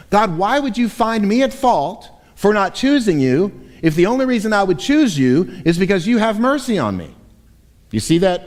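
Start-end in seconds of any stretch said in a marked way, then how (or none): 0.1-7.1 higher, demanding complaint to God; mildly snarky, sarcastic demand; argumentative tone. Retold or imitated. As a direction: press the objection hard. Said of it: "this is a very argumentative tone".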